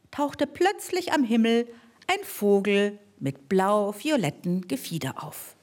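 usual speaking voice

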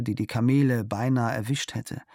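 plain reading voice

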